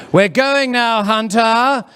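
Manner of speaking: put-on high, loud mum calling her child. Mum at the park summoning Hunter, calling out. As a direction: sing it out across the park. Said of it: "they love calling that name out".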